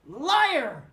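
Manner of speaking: loud high accusing yell; angry shout. A narrator's reading. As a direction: fling the word at him. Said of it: "shouted Peter".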